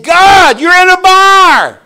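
high, loud cry of amazement, slow at the end; astonished shout. Retold or imitated. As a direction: cry out in shock at God in a bar. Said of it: "And I said".

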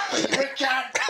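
high-pitched mimicked insult; mock-insult punchline. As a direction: squeak out the punchline, voice jumping up. Acted punchline of retold quarrel.

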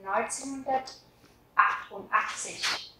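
neunzehnhundertachtundachtzig spoken syllable by syllable; slow model word. Shown as a demonstration. Teacher reading a year slowly for learners. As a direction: stretch out neunzehnhundertachtundachtzig. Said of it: "sẽ là".

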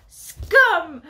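very loud high shriek. Acted. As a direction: shriek the insult. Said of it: "she shrieks".

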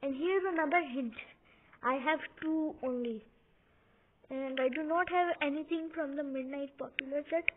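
ordinary talk, nothing marked

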